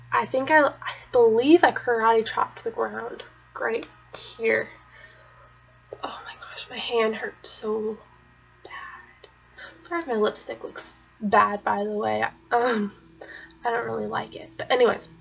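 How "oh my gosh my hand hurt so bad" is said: hushed whisper of remembered pain; soft whispered oh my gosh. Retold or imitated. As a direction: breathe it out as a pained whisper. Remembering the pain in her hand.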